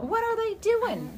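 alarmed. Real, not acted. Reacts to noise outside.

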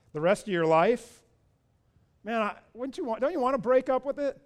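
normal talking voice

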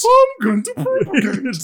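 high, loud, drawn-out mock British; mock British accent. Co-host hams up the propagate joke, mock British accent. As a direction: ham up a British accent, loudly.

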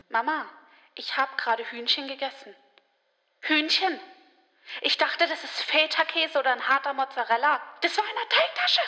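hammed-up wail, quiet and climbing to a squeal; imitated crying fit. Distraught vegetarian phoning her mother about eating chicken, mock sobbing. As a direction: re-enact a tearful call, pitch rising. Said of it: "sowas von einen Heulkrampf bekommen".

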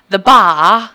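"bar" said "bah" with no r, loud rising; loud Northern English The bar; Northern English accent. Retold or imitated. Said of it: "She kept saying".

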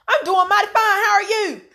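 high, loud exaggerated Southern drawl; country drawl reply. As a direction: ham up a mighty-fine country answer. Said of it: "And it's like".

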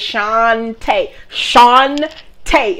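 Shantae drawn out slowly as "shahn... tay"; sounded-out name. Shown as a demonstration. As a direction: break Shantae into two long syllables. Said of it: "Sound it out, baby".

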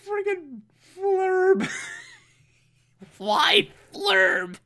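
high and slow, laughing through flurb; giggling at how silly flurb is; laughing disbelief. Real, not acted. Amused viewer laughs at the word flurb, high and giggly. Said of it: "so it's more like flurb".

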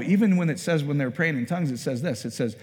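ordinary talk

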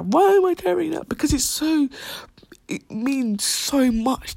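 choked up, pitch leaping upward as emotion hits; tearing up. Real, not acted. Recalling her maths teacher's kind words, tearing up. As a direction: voice cracking high with sudden tears. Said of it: "I'm tearing up right now".

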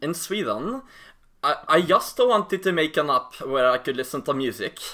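lightly voiced Swedish accent; a hint of a Swedish founder explaining his app. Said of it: "Daniel Ek from Sweden".

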